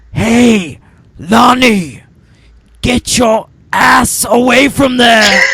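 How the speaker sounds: high, loud, laughing yell of a whisper; loud stage whisper. Retold or imitated. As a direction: bark it out like a loud hissed warning. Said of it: "whispers loudly through the bush".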